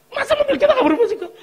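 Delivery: very high, loud mock whine; goading wife. Wife complaining to husband about their car, high mock whine. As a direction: squeal the complaint like a caricatured wife. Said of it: "istri juga ngomporin suami".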